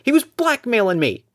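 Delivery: cornered dirty cop pleading; high, defensive excuse; defensive NPC voice. Acted. GM voices NPC Ronnie confronted.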